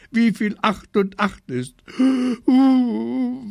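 high wail breaking into loud sobs; hammed-up boohoo, building. A narrator's reading. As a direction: bawl it out like an aggrieved child. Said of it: "weinte ich noch lauter".

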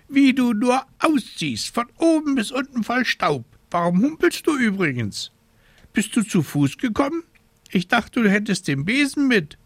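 high, fussy scolding raven voice. A narrator's reading. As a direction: play a worried, scolding raven.

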